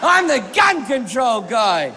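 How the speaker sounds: high cry of yes, then drawn-out boast; triumphant. Retold or imitated. Comedian retells delight at being recognised.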